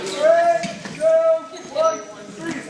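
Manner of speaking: high shouted countdown; loud countdown. Real, not acted. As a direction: call it out across the gym. Calling freeze to class.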